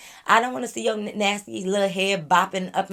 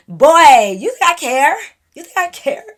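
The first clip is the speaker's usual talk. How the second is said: loud, high, laughing taunt; laughing scornfully; 8 semitones higher, 13 dB louder, slower than usual. Real, not acted. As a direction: laugh it off while shouting back. Mocking the guy, laughing.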